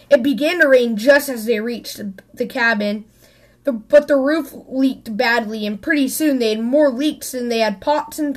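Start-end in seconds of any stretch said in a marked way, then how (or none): none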